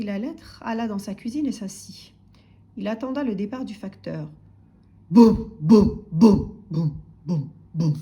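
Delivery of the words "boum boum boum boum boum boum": heavy loud booms fading away; stomping footsteps. A narrator's reading. Postman departing as householder waits, booming steps fading. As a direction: thud out steps receding gradually.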